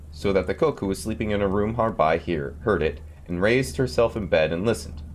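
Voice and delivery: ordinary delivery, nothing marked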